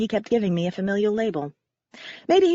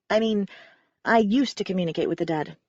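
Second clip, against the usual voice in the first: plain reading voice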